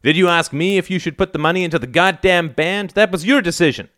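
high, indignant accusation; outraged arguing; heated retort. A narrator's reading. Son argues back at father.

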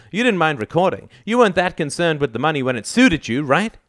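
high, accusing argument; heated father's challenge; demanding. A narrator's reading. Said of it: "demanded his father".

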